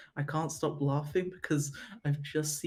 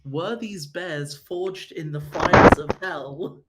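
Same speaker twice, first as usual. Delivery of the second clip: loud, slow, dramatic read; 12 dB louder, slower than usual. Retold or imitated. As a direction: boom it out like a movie trailer. Reading a silly Amazon question.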